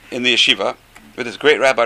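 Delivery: normal talking voice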